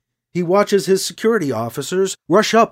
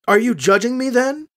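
defensive; 6 semitones higher than usual. A narrator's reading. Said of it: "asks her defensively".

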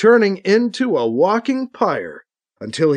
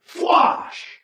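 high, whispery whoosh of a rifle; imitated blaster shot; 13 semitones higher than usual. A narrator's reading. Katie fires at the downed guardsman, an airy imitated blast. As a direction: breathe out a high blast noise.

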